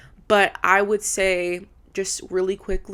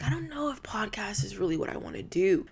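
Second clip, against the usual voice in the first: hushed, whispered aside; softly whispered inner thought; whispered doubt; 2 semitones higher, 5 dB quieter than usual. Retold or imitated. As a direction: whisper it like a private hesitation. Acting out her own hesitant thought.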